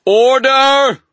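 very high, very loud ringing shout; booming gavel-like cry; ringing call for order. Acted. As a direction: command the whole hall to silence. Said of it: "called out in a ringing voice".

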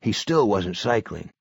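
ordinary delivery, nothing marked

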